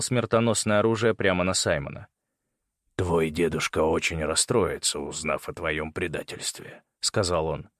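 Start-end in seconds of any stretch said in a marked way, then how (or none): 3.0-6.8 low, measured menace; understated cold threat; villain's taunt. A narrator's reading. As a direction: gloat quietly in a lower voice. Villain taunts his captive.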